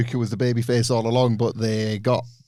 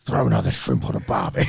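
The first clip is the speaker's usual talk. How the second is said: put-on much lower, broad stock Australian accent; 12 semitones lower than usual. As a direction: play a cliché Aussie at a barbecue. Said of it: "you're better than me".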